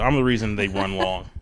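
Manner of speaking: ordinary talk, nothing marked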